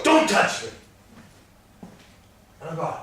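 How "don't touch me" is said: wife's sharp, higher don't touch me, stress on don't; sharp retort. Retold or imitated. Wife pulling away from husband's touch, sharp retort. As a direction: snap it back at him, a touch indignant. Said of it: "And she goes".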